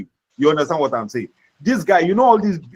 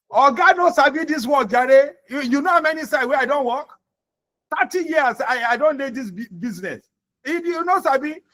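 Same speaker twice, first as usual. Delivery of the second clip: rude boastful worker; 7 semitones higher than usual. Retold or imitated. Worker mocking his boss, high and scornful. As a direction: scoff at the boss in a high, indignant Nigerian Pidgin voice. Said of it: "imagine how some people talk".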